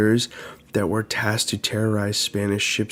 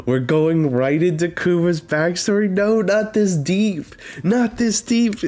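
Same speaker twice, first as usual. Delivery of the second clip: high-pitched, rising disbelief; dismayed outburst; 8 semitones higher than usual. Real, not acted. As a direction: protest it in real dismay, voice climbing high. Dismayed reaction to backstory.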